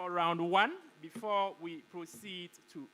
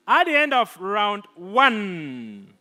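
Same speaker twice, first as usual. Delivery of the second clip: loud, booming results announcement; announcer voice; 2 semitones higher, 14 dB louder than usual. Acted. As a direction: proclaim the scores to the hall. Announces scores to the hall.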